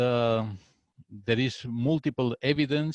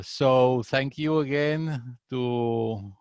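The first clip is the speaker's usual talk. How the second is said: ordinary delivery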